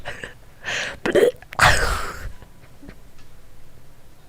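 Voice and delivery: high burst of stifled laughter; splutter, then wheeze with laughter. Real, not acted. Player hearing the suitor boast of his physique, spluttering laughter.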